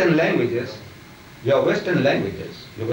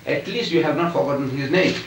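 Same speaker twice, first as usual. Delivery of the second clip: normal talking voice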